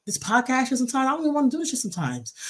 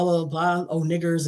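usual speaking voice

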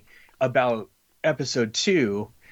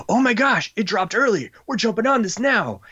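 excited fans; 9 semitones higher than usual. Retold or imitated. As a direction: mimic thrilled backers jumping in. Fans excited about early launch.

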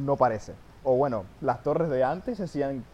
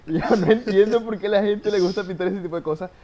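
high, baffled complaint; exasperated; 6 semitones higher, 4 dB louder than usual. Real, not acted. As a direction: throw up your hands.

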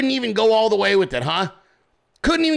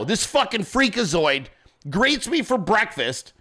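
ordinary talk, nothing marked

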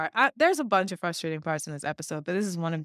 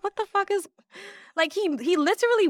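incredulous; 11 semitones higher than usual. Retold or imitated. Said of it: "And I'm like".

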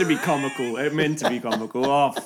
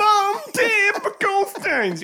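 high, loud whiny call to the parents; hammed-up sick baby voice; mock whinge; 5 dB louder, much slower than usual. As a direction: whine for mum like a sick child. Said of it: "You're doing such a bitch when you're sick".

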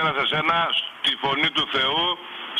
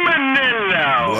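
loud, much higher, very slow yell; drawn-out shout; 11 semitones higher, 5 dB louder, much slower than usual. Real, not acted. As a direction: stretch the name out in a shout. Caller shouts name drawn out.

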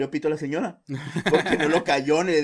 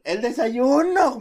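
higher, louder, slow drawn-out call; nagging wife; 9 semitones higher, 6 dB louder, much slower than usual. Retold or imitated. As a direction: stretch it out like a scolding wife. Mother nagging husband. (la vecina le recuerda el desayuno a Mario, alargando la voz aguda)

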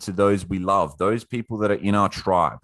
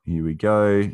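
ordinary talk

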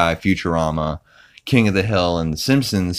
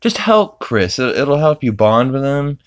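imitating Lois; 8 semitones higher than usual. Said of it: "Lois is like".

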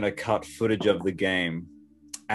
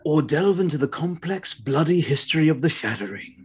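higher, grand trailer voice; demo trailer read; 6 semitones higher than usual. Shown as a demonstration. Co-host demonstrating the trailer line for the reader, epic tone. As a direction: show him how the trailer line should sound. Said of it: "I'll give you one".

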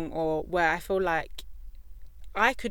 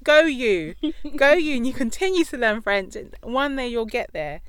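a higher, louder hammed-up cheer for the nephew; playful mock cheer for a nephew learning French; 5 semitones higher, 8 dB louder than usual. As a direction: cheer him on like a proud aunt. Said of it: "And I'm like".

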